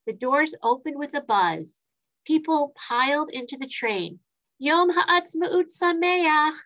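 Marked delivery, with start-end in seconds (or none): 4.6-6.6 slow, high, bright holiday greeting; cheerful call. A narrator's reading. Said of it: "called Ariel cheerfully".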